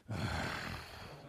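long heavy sigh, low and soft; deflated sigh of dread; weary sigh. Retold or imitated. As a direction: sigh like you're already tired of her. Dreading the worship leader again.

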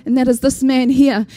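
usual speaking voice

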